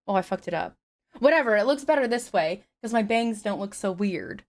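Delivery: usual speaking voice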